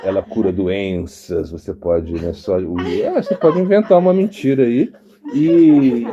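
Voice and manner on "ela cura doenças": mock sales pitch. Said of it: "Você fala".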